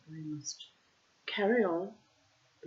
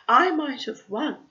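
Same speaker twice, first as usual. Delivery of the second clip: snort; 8 semitones higher, 13 dB louder than usual. Acted. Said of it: "snorted Warthog".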